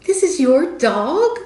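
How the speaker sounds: astonished question. Retold or imitated. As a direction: play her shocked disbelief, slowly. Retold lady's disbelief.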